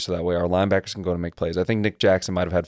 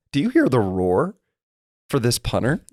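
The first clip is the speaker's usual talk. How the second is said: lightly imitated announcer, pitched up; mock broadcast amazement in a higher voice; 4 semitones higher than usual. Quoting TV announcers on the punter, imitated announcer amazement. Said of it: "the announcers on T V were talking about".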